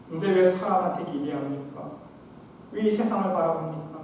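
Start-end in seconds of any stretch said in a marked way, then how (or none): none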